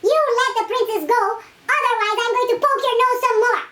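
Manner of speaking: very high, fast bossy threat; tiny hero threatening. Acted. Isumboshi orders the ogre to free the princess, high and fast. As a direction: threaten like a brave little boy.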